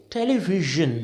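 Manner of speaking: "टेलीविजन" low, with soft zh as in vision; careful zh sound. Shown as a demonstration. Teacher modelling for learners, "टेलीविजन" with a zh. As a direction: say "टेलीविजन" as te-li-vi-zhun, neither z nor j. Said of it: "इसको आप क्या बोलोगे?".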